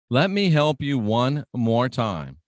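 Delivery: usual speaking voice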